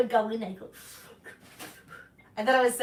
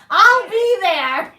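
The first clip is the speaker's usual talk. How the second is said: laughing; 9 semitones higher, 11 dB louder, much slower than usual. Real, not acted. Joke ending in laughter.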